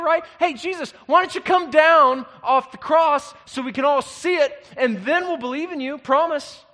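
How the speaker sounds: high, loud sneering taunt; mocking crowd voice, sarcastic and loud. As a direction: jeer up at the man on the cross. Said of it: "Can you hear the mocking tone in this?".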